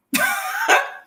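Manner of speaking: short high laugh of disbelief. Real, not acted. Laughing at Baba's request.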